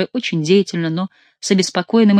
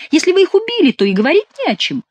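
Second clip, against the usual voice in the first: high, louder exclamation; mock enthusiasm; 13 semitones higher, 4 dB louder than usual. A narrator's reading. Chicot says there's nothing to discuss, mock enthusiasm. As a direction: sing out the conclusion with lifted pitch.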